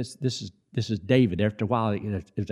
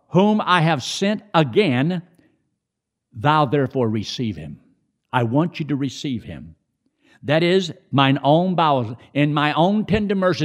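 higher, louder preaching read; scripture reading; 5 semitones higher, 4 dB louder than usual. Acted. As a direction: proclaim the verse with fervour.

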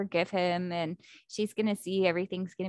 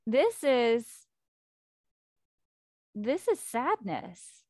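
slow, halting realisation of loss; dawning grief; much slower than usual. Retold or imitated. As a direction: let each word land as the grief sinks in. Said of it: "Thanatos is like".

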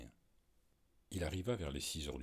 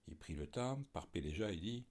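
plain reading voice

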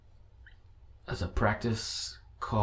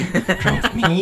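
mock whiny repeat; 8 semitones higher, 6 dB louder, slower than usual.

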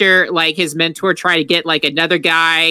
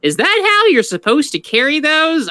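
high-pitched quote; 10 semitones higher than usual. Retold or imitated. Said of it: "they're like".

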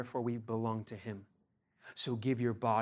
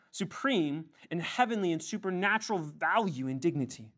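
higher, louder pulpit insistence; preaching at full force; 6 semitones higher, 3 dB louder than usual. Acted. Pastor rebutting a quote to his congregation in a louder, higher preaching voice. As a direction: drive the point home from the pulpit.

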